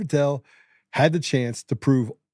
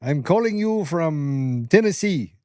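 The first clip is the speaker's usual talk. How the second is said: mock Indian scam caller; 4 semitones higher than usual. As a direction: mimic a call-centre scammer, only half committed. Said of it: "Bob Smith from India called me".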